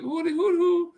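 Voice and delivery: put-on high hooting like jeering kids; mock hooting kids. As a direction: hoot it up high like a brat. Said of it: "They're like".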